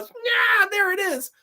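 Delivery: high-pitched unhinged outburst; mock crazy man. Imitating the crazy father in the film, high mock outburst. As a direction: imitate a man suddenly cracking up, pitch leaping. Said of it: "William Finley goes crazy".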